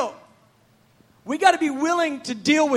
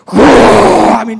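very loud rushing whoosh; imitated train roar; 3 semitones lower, 14 dB louder than usual. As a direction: blast out the noise of a roaring train. Imitates the roaring freight train.

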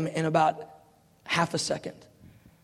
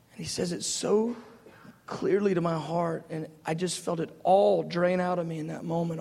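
ordinary talk

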